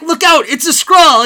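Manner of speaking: high, loud, laughing yell; laughing shout. Real, not acted. Fan quoting Hawkeye's warning, laughing and loud. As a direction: yell it through giggles. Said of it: "I'm laughing right now talking about it".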